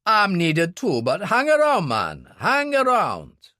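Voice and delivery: the barman introduces his wife, eager; Billy the barman. Acted. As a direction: play the barman, keen and open. Voices Billy, friendly.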